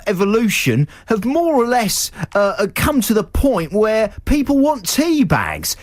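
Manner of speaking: high put-on Scottish voice; lecturing dragon, pitched high in Scottish; Scottish accent. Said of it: "say it in a Scottish accent".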